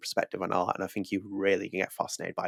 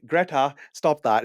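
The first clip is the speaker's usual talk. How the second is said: higher, louder, drawn-out villain impression; Wolfenstein villain; 7 semitones higher, 5 dB louder, slower than usual. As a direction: call Greta off, slowly, as the villain. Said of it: "It's like Greta's just killing that guy".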